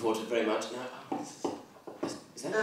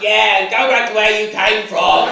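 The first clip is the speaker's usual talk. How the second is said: imagined heckle; 6 semitones higher, 10 dB louder than usual. Pretend. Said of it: "he might have shouted out".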